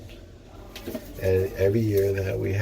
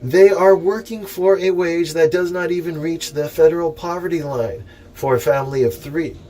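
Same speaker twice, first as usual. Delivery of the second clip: raised, louder reading-aloud voice, lifting each phrase; formal letter reading; 7 semitones higher, 5 dB louder than usual. Acted. Reading the minimum wage letter to the viewers, in a raised formal speech voice. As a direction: read the petition out like a speech.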